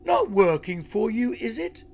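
high-pitched, teasing fake shock; cheeky mock-innocent question; mock concern. A narrator's reading. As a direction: taunt the enemy with feigned surprise. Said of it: "put on his very best".

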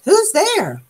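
high, far-off sleepy creature voice; startled again. A narrator's reading. Old Armadillo calling again toward the knocking, high startled voice. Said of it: "woke with a jump".

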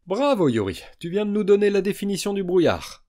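high, loud sarcastic mock praise; loud, sarcastic snicker of a compliment; sneering. A narrator's reading. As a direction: needle him with fake praise. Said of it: "ricana Xia".

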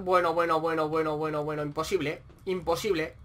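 low, soft string of repeats; low disbelieving mutter. Real, not acted. Gamer seeing the match slip away, muttering low. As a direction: mutter it under your breath in disbelief.

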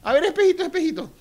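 high, sing-song mocking voice; mock vain mayor. Mayor asking her magic mirror, mocking high voice. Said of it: "pintarme y decir".

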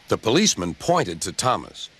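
usual speaking voice

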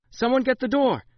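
loud, high shout for help; yell. A narrator's reading. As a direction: yell across the room to stop him. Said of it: "she yelled".

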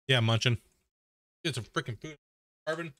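chewing while talking; mouth full. Real, not acted. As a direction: talk through a mouthful of chips. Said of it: "now that you guys just heard me chomp on some chips".

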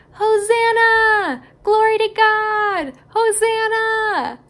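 joyful yell. Acted. Crowd hailing Jesus on his donkey, slow joyful yell. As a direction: shout it like the crowd at the gate. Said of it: "they yelled".

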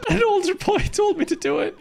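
high-pitched mocking echo; mimicking game character. Gamer echoing a cartoon excuse back, high mimicry. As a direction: parrot the boy's excuse in a high voice.

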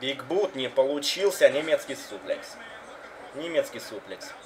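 live wrestling play-by-play; wrestling commentator. Acted. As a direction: call the match live. (комментатор рестлинга)

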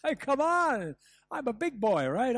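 loud, high-pitched self-assurance; proud bravado. Acted. Man stuck in the cellar to himself, proudly indignant. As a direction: protest that you need no help. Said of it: "because of my pride".

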